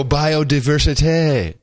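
biodiversité said slowly, French-style; French accent. Shown as a demonstration. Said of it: "we should also pronounce it like that".

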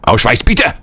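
mock German officer barking orders; mock German border guard demanding papers. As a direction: bark it like a wartime checkpoint guard.

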